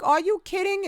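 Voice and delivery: high, stunned "are you kidding"; disbelief. Retold or imitated. Watching the cancer patient's clip, disbelieving. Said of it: "I was like".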